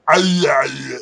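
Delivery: high yelled Schwarzenegger growl. Shown as a demonstration. Actor demonstrating the scripted growl, Schwarzenegger-style yell. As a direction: mimic a roaring Schwarzenegger noise. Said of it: "just make it, like, a".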